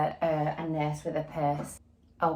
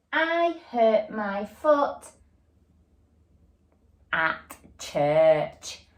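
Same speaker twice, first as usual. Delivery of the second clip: slow, word-by-word reading; much slower than usual. Shown as a demonstration. Teacher reading the sentence to pupils, slow and even. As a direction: read each word slowly as you point. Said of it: "the next part is where".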